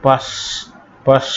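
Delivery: "পাস" ending on released air; hissed final S. Shown as a demonstration. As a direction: let air out between the lips on the s of "পাস". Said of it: "দুই ঠোঁটের মাঝখান দিয়ে একটু গোল করে". ("পাস"-এর শেষে বাতাস ছেড়ে দন্তস্য ধ্বনি)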